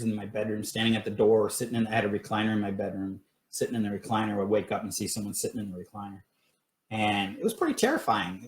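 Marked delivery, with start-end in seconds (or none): none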